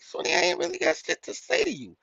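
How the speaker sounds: high, dismissive; mock high-pitched dismissal; dismissive brush-off. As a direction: brush her off. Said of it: "I'm like".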